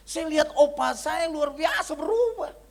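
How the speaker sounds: high, loud, drawn-out grandchild voice; amazed grandchild. Retold or imitated. Grandchild praising a changed grandfather, high loud mock amazement. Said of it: "cucunya bilang begini".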